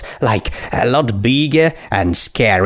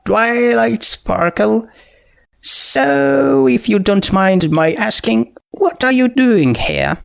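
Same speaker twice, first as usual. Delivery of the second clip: put-on a slightly higher, careful young mare; polite but puzzled, a bit raised; lightly voiced Twilight; 9 semitones higher than usual. Twilight questions the skeleton.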